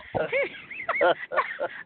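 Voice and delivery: a quick high burst of laughter; sudden high giggle. Real, not acted. Host laughs at the pills joke.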